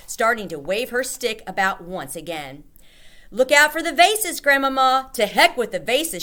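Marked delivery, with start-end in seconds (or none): 3.3-5.1 warning call. A narrator's reading. Boy warns waving grandmother. 5.1-6.2 louder, carefree outburst; gleeful shout. A narrator's reading. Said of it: "she shouted".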